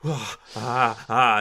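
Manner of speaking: loud, high offended gasps; sputter, too mad to talk; acted gasps of fury; speechless outrage. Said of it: "she can't talk for a second. She is so mad".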